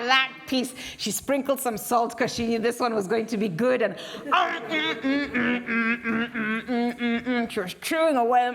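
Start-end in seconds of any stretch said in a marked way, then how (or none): 3.8-6.9 savoring hum. Acted. Tasting meat again.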